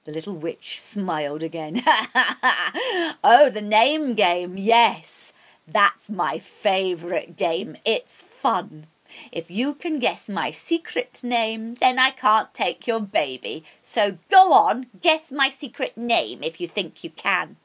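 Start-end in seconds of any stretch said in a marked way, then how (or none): 1.9-3.2 louder wicked witch cackle; a gleeful crone's cackle. Acted. Witch laughs. 3.2-17.6 witch voice, louder and slow, relishing the game; a crone taunting, sure she will win; gleeful witch. Acted. Witch accepts the name game.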